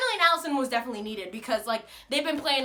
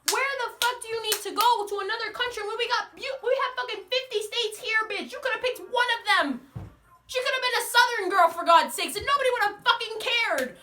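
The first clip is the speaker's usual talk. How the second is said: mock outrage; 8 semitones higher than usual. Ranting at the show's writer.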